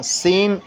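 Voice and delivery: سین with a hissing whistle; a sharp whistled سین; whistling seen. Shown as a demonstration. Tutor confirming the student's سین. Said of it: "سیٹی کی طرح".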